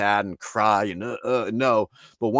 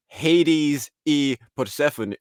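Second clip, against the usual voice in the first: imitating Spanish names said with English sounds; English pronunciation; 6 semitones higher, much slower than usual. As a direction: Hades as HAY-deez, in English. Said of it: "he was saying it all English".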